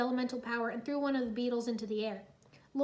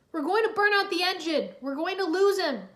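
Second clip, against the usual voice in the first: loud, higher panicked shout; 6 semitones higher, 8 dB louder than usual. Acted. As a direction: yell over the strain of the engine. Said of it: "Cole shouted".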